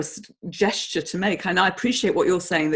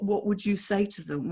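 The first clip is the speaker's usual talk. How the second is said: ordinary talk, nothing marked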